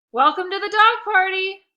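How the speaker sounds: eager host greeting, loud and bright; big smiling welcome. Acted. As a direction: sell it with your biggest smile. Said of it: "I exclaimed with my biggest smile".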